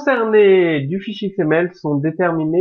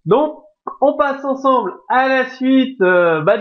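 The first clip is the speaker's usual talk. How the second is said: ordinary delivery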